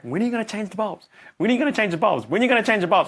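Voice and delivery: imitating an impatient wife, repeating it; nagging, pushier each time; mock frustrated nagging. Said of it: "Penny was getting really frustrated".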